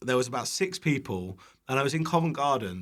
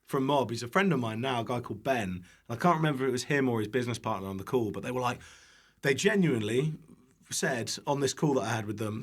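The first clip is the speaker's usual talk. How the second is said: ordinary talk, nothing marked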